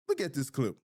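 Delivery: ordinary talk, nothing marked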